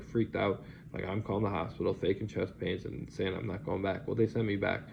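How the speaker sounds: ordinary delivery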